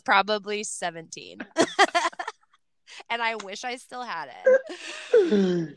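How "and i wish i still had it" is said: laughing. Real, not acted. Laughing at old memento.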